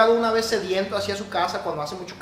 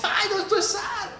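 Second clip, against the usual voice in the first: high, startled wife's voice; wife's dismay; 13 semitones higher than usual. Retold or imitated. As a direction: squeal it like the surprised wife. Wife tastes salted coffee.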